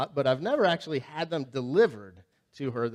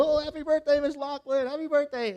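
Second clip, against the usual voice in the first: put-on much higher, sing-song kids greeting; cheery students; 11 semitones higher than usual. Students greet Miss Loughlin on her birthday, higher and cheery. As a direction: play excited schoolkids.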